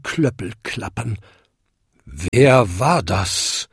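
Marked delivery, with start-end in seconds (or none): 2.0-3.7 slow, drawn-out booming question; booming big bell. A narrator's reading. Biggest bell startled from sleep, booming slowly. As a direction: boom it out like a huge bell. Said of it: "dröhnte die größte Glocke".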